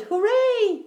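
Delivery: high, drawn-out hooray; bellowed cheer. A narrator's reading. Bear celebrates finding food, bellowing with glee. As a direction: cheer gleefully, pitched right up. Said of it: "he bellowed".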